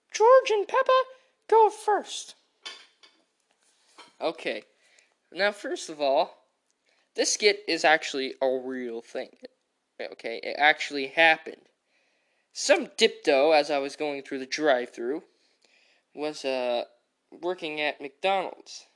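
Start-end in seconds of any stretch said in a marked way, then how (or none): none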